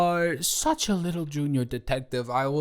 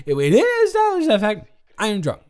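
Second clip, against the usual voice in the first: higher, louder and fast; indignant outburst; 6 semitones higher, 7 dB louder, faster than usual. Real, not acted. Drunk streamer snaps at partner who questions her, loud and fast. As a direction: burst out defensively.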